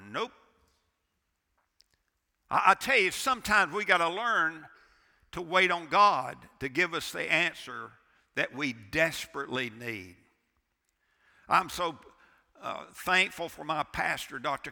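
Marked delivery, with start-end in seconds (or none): 0.1-10.2 loud, driving preacher's build; fiery sermon delivery; preaching at full force. Acted. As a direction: hammer the point home from the pulpit.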